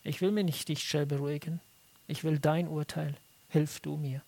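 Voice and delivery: soft, low plea for judgment; hushed, low, sincere prayer; humble prayer. Retold or imitated. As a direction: pray quietly and earnestly for honesty. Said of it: "in diesem einen Punkt sagen sollten".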